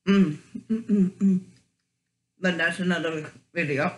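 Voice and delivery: chewing while talking; speaking between bites; mouth full. Real, not acted. Seen 3: Chewing motions before and during speech, napkin wiped across mouth midway.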